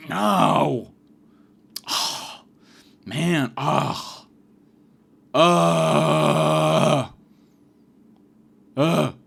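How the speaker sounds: exaggerated groans and sighs; mimicked groaning. Classmate refusing the nurse, mock groaning. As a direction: moan a refusal, then huff and groan. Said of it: "She just does that the whole time".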